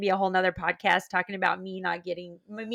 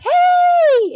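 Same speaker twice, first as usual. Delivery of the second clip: excited shout; 15 dB louder than usual. Retold or imitated. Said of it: "they're like".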